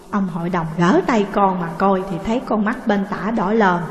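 plain reading voice

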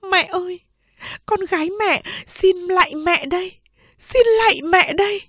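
high, tearful bowing plea; tearful farewell plea. Acted. Second sister bowing to mother in tearful farewell. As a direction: plead as you bow.

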